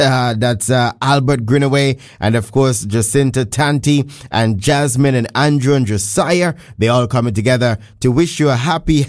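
ordinary talk, nothing marked

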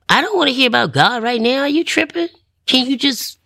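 high, retold exasperation; exasperated protest. Woman recalling refusing talk about God, exasperated.

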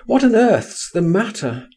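high, urgent questioning; crying out. A narrator's reading. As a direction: exclaim the question, pitch falling at the end. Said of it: "I cried".

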